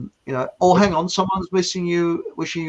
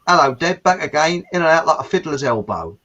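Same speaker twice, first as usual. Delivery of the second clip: ordinary talk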